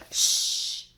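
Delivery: sharp loud shh; loud hushing shh. Acted. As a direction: hush them urgently. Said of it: "the others whispered".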